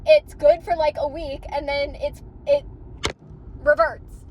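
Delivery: loud, high-pitched furious rant; real fury. Real, not acted. Telling viewers about the maintenance visit, a loud furious rant. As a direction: vent your fury at the repair guys. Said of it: "I'm so fucking pissed".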